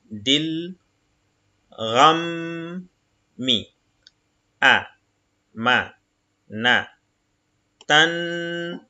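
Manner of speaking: each Arabic syllable said apart, slowly; syllable reading. Shown as a demonstration. Teacher reading Arabic syllables to the class, slow and separated. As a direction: spell the word out syllable by syllable. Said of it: "Ok, donc".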